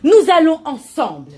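loud rallying proclamation; rally the crowd; proclaiming. Acted. Guru proclaiming.

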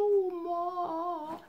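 high, crying little bunny; sad bunny voice. Acted. Sick bunny Hops whines to grandma, high crying voice. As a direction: whimper his grandma's name. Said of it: "so ganz traurig und sagte".

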